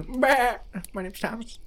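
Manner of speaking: high, slow bleating goat; goofy talking-goat impression. Voices the goat Thomas, bleating.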